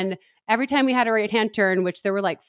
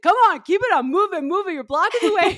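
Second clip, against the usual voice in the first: high, loud hurry-up call; hammed-up finish-line official shouting; imitating a pushy marshal, higher and louder; mock race marshal yell; 11 semitones higher, 4 dB louder than usual. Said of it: "this guy's like".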